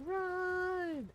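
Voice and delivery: high, urgent run call; panicked call to flee; alarmed cry. Real, not acted. Fleeing from guards.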